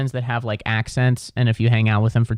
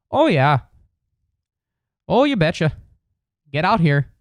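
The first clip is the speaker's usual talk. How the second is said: high, mocking Minnesota accent; Upper Midwest accent; 5 semitones higher than usual. Mocking people in Michigan, Wisconsin and Minnesota, hammed-up Upper Midwest accent. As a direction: exaggerate the Upper Midwest vowels. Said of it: "not good at accents".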